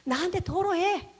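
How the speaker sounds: imitating an insistent, inviting Father; God the Father urging. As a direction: urge the child to let it all out. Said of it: "아버지가 원하시는 건".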